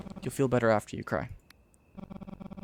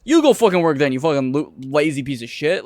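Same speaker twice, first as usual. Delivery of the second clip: higher and louder, spitting anger; angry cursing; 4 semitones higher, 8 dB louder than usual. Real, not acted. Actor cursing the lazy character, angry. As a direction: tell them off hard.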